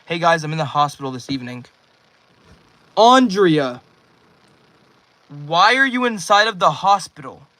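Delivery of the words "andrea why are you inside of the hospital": loud startled question; screaming. Real, not acted. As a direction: shout it out in disbelief. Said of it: "sorry for screaming".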